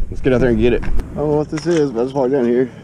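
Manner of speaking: normal talking voice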